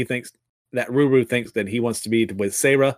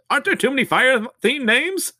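much higher, exasperated question; incredulous; 12 semitones higher than usual. Real, not acted. Recapper reacting to too many fire names, incredulous squeak. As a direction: squeak out the disbelief. Narrator interjects in disbelief.

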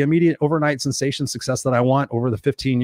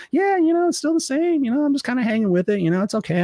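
vague client reply; 11 semitones higher than usual. Retold or imitated. Imitating a stuck client.

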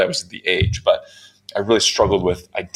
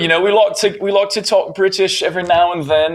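high-pitched mock British accent; American doing a hammed-up British voice; put-on British accent; 10 semitones higher than usual. Shown as a demonstration. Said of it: "I would like to hear the British accent".

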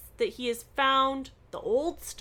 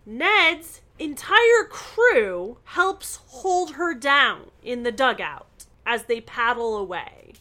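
ordinary delivery

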